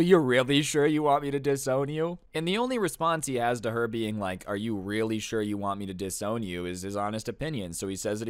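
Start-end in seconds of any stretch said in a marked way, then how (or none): 0.0-2.2 raised, questioning disbelief; pitched-up, pressing question; incredulous. Retold or imitated. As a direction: imitate a stepmom double-checking in disbelief. Acted stepmom backpedalling, incredulous question.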